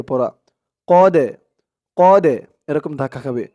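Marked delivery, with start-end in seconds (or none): 0.9-2.3 قد with the د released in a sharp bounce; qalqala bounce. Shown as a demonstration. Teacher showing the qalqala echo. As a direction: pop the د at the end of قد. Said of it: "ধাক্কা দিয়ে পড়তে হবে".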